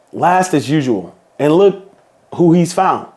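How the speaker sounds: ordinary delivery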